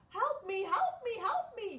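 high, loud frantic cries; scared bunny. Acted. Frightened bunny begs for help, high and loud. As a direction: cry out like a hunted rabbit. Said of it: "he said".

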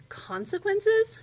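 slow, high, loud disbelief; incredulous witch. A narrator's reading. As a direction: throw the threat back as a drawn-out question. Witch challenges the princess's threat.

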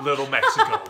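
very high, loud, through laughter; laughing insistence. Real, not acted. Joker doubling down for the viewers, laughing loudly. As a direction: crack up while insisting on the joke.

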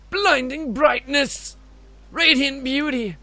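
high, slow theatrical exclaiming; rapturous character. Acted. Game character marvelling at the dive, rapturous. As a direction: draw out each word in grand awe.

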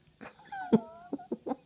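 a high, loud laugh at her own slip; burst of laughter. Real, not acted. Reader cracking up at her own flub, loud laugh.

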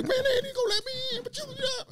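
high squeaky laughing voice for his buddy; mock high-pitched pal; friend's high voice. Friend voiced in a retold story.

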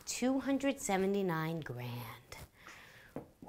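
slow, unimpressed whisper; sarcastic whisper. Acted. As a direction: breathe the number back, deflating it. Wife repeating the figure unimpressed.

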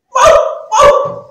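sudden high, very loud yelp of a frightened dog; startled bark. A narrator's reading. Dog hit by a falling fruit, startled loud bark. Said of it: "Die erschrak sich und bellte".

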